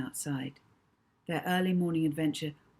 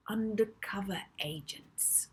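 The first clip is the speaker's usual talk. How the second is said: whisper; 3 semitones higher, 5 dB quieter than usual. Acted. Secretive boast about being agents.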